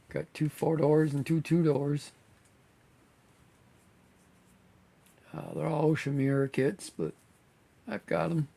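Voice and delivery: slightly hoarse, voice going; losing voice a little, hoarse. Real, not acted. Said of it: "losing my voice a little bit".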